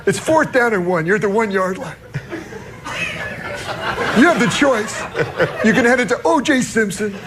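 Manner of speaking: high, giggling retelling; can barely hold the laugh; laughing through a quote. Real, not acted. Host quoting Super Dave's football question, laughing.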